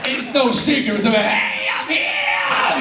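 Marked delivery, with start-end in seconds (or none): 1.2-2.8 much higher, big shouted rock star entrance. Retold or imitated. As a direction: yell it like a star who wants everyone to notice him. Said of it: "[name], who announces himself".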